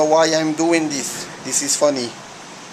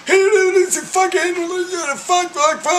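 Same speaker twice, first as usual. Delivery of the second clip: very high squeaky swearing; high, pinched cartoon voice spitting curses. Swearing in a silly voice.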